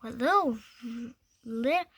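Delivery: plain reading voice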